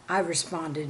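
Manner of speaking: ordinary delivery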